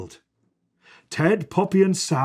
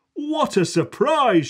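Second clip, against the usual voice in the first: higher, happy surprise; girl delighted by the lamb; mild surprise; 10 semitones higher than usual. Acted. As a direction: savour the surprise. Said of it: "she said".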